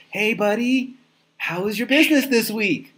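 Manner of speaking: high, sing-song coddling voice; baby a grown man gently. Retold or imitated. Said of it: "coddle them and be like".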